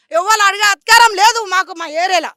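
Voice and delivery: loud, forceful assertion; defiant protest. Real, not acted. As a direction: stand your ground loudly. Defiant claim to their land. (ధిక్కారంగా గట్టిగా)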